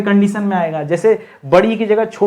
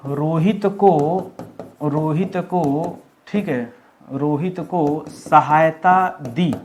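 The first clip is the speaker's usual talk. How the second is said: slow dictation; 3 semitones lower, 5 dB quieter, much slower than usual. Shown as a demonstration. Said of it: "तो लिख रहा है".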